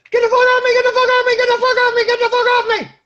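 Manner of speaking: high, loud frantic scream over and over; panicked screaming. Retold or imitated. Pinned under the dying Strom, screaming for it to get off, panicked high scream. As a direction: shriek it like your arm is breaking. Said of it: "a very civilized".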